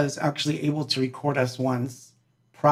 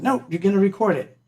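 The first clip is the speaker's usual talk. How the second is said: higher, fast, pushy insistence; raised eager insisting; 6 semitones higher, faster than usual. Retold or imitated. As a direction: chase him down with it, raised and quick. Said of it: "And I was like".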